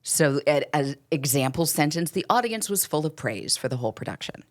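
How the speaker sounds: ordinary talk, nothing marked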